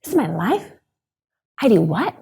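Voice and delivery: put-on high, breathless little-girl wonder; a child who can't believe what she sees; awed little girl. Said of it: "she's sitting there in the car being like".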